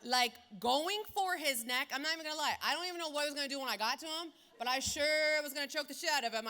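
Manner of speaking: loud, high, furious retelling; heated, building fury; fired up. Real, not acted. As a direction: relive the rage at full volume. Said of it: "I saw red".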